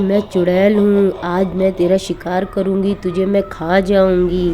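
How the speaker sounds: ordinary delivery, nothing marked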